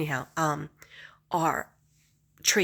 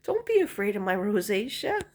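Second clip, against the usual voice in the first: high, louder, pleading surprise; mock protest; 5 semitones higher, 4 dB louder than usual. To the Russian beautician, high and pleading. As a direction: protest it high, half laughing. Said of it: "I was like".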